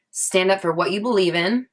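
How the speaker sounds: ordinary talk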